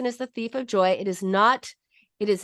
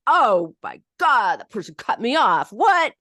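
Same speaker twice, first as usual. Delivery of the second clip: anger let out; 2 semitones higher, 5 dB louder than usual. Shown as a demonstration. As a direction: explode at the driver who cut in. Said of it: "We let that, that, that affect out".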